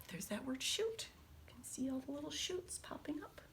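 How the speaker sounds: whispered aside. Real, not acted. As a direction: softly point it out in a whisper. Whispered aside during reading.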